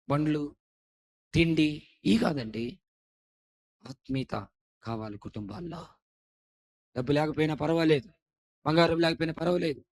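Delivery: ordinary talk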